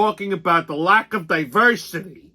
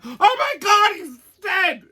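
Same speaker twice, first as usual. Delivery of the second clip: hysterical caller; 12 semitones higher than usual. Retold or imitated. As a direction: shriek like a hysterical fan.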